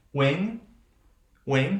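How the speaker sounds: WING ending on a velar nasal, g left silent; silent final g. Shown as a demonstration. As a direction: say WING with no hard g at the end.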